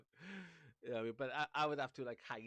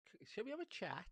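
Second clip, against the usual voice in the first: suspicious musing; 11 semitones higher, 3 dB quieter than usual. Retold or imitated. Own retold suspicious reply.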